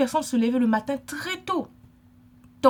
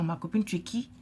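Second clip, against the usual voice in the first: a soft, whispered dig; whispered rebuke; 4 semitones lower, 6 dB quieter than usual. Acted. Scolding the sister who brushed off the apology, in a whisper. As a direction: whisper the challenge pointedly.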